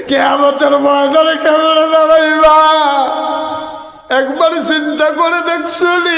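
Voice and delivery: very high, stretched preaching cry; impassioned wailing reproach. Real, not acted. Preacher asking the congregation what kind of Muslims we are, wailing reproach.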